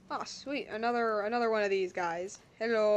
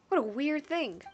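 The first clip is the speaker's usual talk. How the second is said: ordinary delivery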